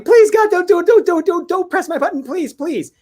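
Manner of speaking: frantic high-pitched pleading yell; acted panic, screeching pleas in a rush of don'ts; screaming and yelling. Robot begging them not to press her button, screaming. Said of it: "makes her start screaming and yelling".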